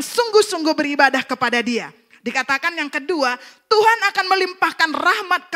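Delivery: loud, high proclamation of scripture; forceful preacher ringing out each phrase; full-force preaching. Real, not acted. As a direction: declaim it to the whole congregation. Proclaims sermon point and scripture.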